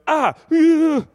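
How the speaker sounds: very high, loud mock scream; hammed-up yelp then long high whoop; startled shriek. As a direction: act out an embarrassing double fright. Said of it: "don't expect to get scared".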